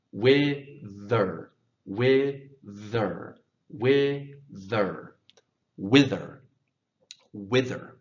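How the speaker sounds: wither said again and again, clear TH; repeated drill. Shown as a demonstration. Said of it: "let's practice it again".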